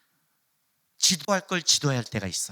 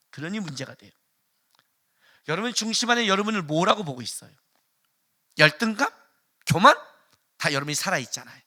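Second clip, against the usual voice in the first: ordinary delivery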